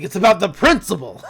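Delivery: lightly voiced stubborn old crook; Stan Pines. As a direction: insist with mock gravity. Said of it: "So we cut over to Stan".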